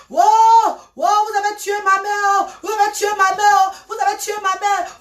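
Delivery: high wailing cry of grief. Retold or imitated. Daughter wailing in a dream over her mother's death, high cry. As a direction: wail it out at the crossroads. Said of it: "Je suis en train de crier".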